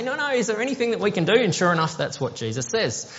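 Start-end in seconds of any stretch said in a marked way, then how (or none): 0.0-1.3 begging. Pretend. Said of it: "start begging and say".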